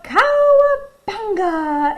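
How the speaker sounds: long, high, drawn-out whooping shout; slow, gleeful battle cry before a sled ride. A narrator's reading. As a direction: holler it out high, stretching the vowels. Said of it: "he would shout".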